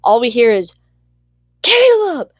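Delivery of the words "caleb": very high, loud call of a name; imitated yell. Kid hollering to Caleb down the street, imitated high yell.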